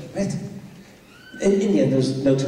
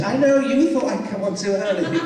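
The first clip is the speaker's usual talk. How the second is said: laughing; 6 semitones higher than usual. Retold or imitated. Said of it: "I said".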